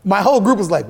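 ordinary talk, nothing marked

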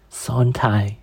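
breathy whispered name. Acted. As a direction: whisper it weakly. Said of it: "she replied".

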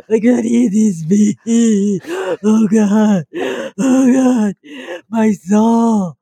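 slow wailing lament through laughter; mock crying. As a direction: wail it, hammed-up sobbing. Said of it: "And they're crying".

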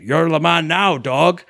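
imitated Scottish movie-star accent; Sean Connery. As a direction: put on a Scottish accent, loosely impersonating. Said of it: "say it in a Sean Connery accent".

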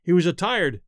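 ordinary delivery, nothing marked

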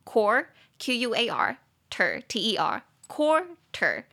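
"quarter" split slowly into "core" and "ter"; slow syllable-by-syllable breakdown; spelling out syllables. Shown as a demonstration. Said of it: "两个音节".

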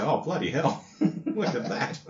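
laconic Aussie bloke. Retold or imitated. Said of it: "the typical laconic Aussie bloke".

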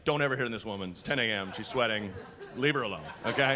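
low, soft, solemn reference-book voice; encyclopedia entry voice. Pretend. Comic voicing an encyclopedia warning in a low solemn tone. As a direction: read it out like a deadpan low narrator. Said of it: "in the encyclopedia, like".

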